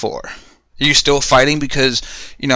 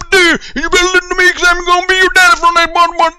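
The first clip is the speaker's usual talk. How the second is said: mock drill sergeant, much higher and louder; drill sergeant yelling; 4 dB louder than usual. Drill sergeant yelling at a recruit, hammed-up high shout. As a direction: scream orders at a recruit like a parody drill instructor. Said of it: "having people yelling at you".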